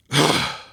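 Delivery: loud forceful breath out through the mouth; forceful exhale. Shown as a demonstration. Coach showing the explosive breath, forceful exhale. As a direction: push the air out hard, almost a grunt. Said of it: "a huh sound when you do it".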